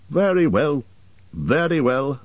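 mildly put-upon elf agreement; grudging assent. A narrator's reading. Elves give in to butler.

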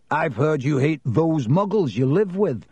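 ordinary delivery, nothing marked

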